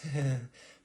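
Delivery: small, high nervous chuckle; sheepish laugh. Acted. Assassin warned off by dagger, sheepish little laugh. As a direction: laugh it off awkwardly. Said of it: "he sheepishly laughs".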